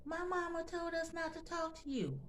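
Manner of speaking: put-on high small child's voice repeating his mama; little boy voice. Toddler telling the neighbor his mama's rule, high child voice. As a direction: lightly voice a three-year-old innocently. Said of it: "He'd say".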